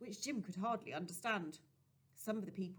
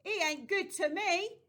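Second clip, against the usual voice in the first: put-on a boy's loud, high defiant yell; furious shout; 11 semitones higher, 11 dB louder than usual. A narrator's reading. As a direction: yell it back at the preacher. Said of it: "Jim shouted".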